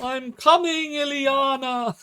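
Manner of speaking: hammed-up cry of a madman chasing his dead wife; mad widower's cry. Streamer mocking the grieving madman, high loud wail.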